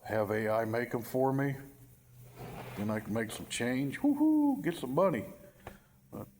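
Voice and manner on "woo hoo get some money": high, loud gleeful woo-hoo; excited whoop. Pretend. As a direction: whoop it like hitting a jackpot. Mock cheer about making money.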